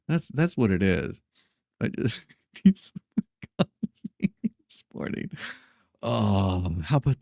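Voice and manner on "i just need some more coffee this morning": laughing through the words. Real, not acted. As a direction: giggle through it.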